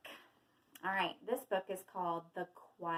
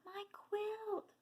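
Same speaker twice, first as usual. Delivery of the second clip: distressed quail; 12 semitones higher than usual. A narrator's reading. Quail losing his quilt to a dog, thin high cry. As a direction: cry out weakly. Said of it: "cries Quincy".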